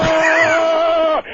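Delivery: high, loud yell of pain; sudden piercing scream of pain. Retold or imitated. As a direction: scream out like your ear was bitten. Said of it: "as loud as you could hear it".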